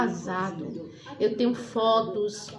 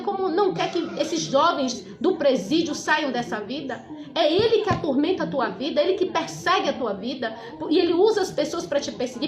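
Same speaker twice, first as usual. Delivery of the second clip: fervent preaching; 5 semitones higher than usual. Acted. Preacher confronting the despairing son, fervent and forceful. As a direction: preach it with fire and conviction.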